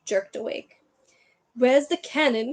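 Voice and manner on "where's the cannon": loud, dim-witted blurt; stupidly. A narrator's reading. Dudley jerked awake by a crash, stupidly. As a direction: blurt it out dopily. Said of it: "he said stupidly".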